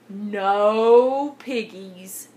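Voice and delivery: very slow farmer drawl; lazy, stretched-out farmer answer; farmer character. A narrator's reading. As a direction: draw each word right out, unbothered.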